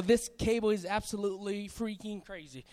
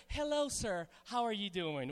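lower, softer mock-polite greeting; stiffly formal greeting; 4 semitones lower, 6 dB quieter than usual. Shown as a demonstration. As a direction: greet formally, in full words. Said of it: "So we don't say".

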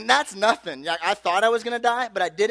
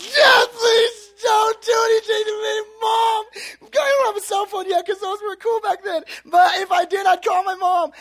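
high, loud wailing plea; hammed-up screaming, sobbing little kid; mock crying panic; 9 dB louder than usual. Nine-year-old begging the angry cop, wailing in panic. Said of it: "I'm freaking out, screaming, crying my head off".

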